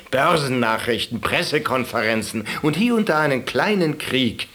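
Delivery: normal talking voice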